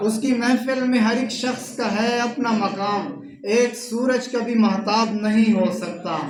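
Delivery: poetry recitation. Acted. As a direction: recite the couplet to the gathering, line by line. Recites couplets at a mushaira. (मुशायरे के अंदाज़ में शेर पढ़ना)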